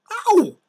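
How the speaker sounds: sudden high loud yelp; pained yelp. Acted. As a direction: yelp as if bitten. Bites a thistle.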